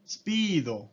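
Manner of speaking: Speedo as speedo, vowel added at the end; Japanese loanword. Shown as a demonstration. Teacher repeating the Japanese form: スピード. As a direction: clip Speedo into Japanese syllables. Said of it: "Entonces speed".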